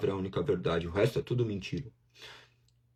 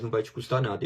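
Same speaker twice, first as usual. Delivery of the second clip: ordinary talk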